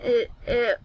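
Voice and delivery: usual speaking voice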